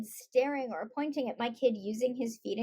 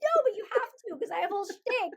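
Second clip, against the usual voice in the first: high-pitched, laughing comic protest; hammed-up flustered objection; mock panic; 8 semitones higher, faster than usual. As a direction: plead in pretend dismay, close to laughter. Retells panic when shtick fails.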